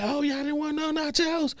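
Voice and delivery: squeaky put-on voice, a touch of innocence; high mock voice of the worker. Imitating the cook asking if nobody wanted nachos, high squeaky voice. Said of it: "She's like".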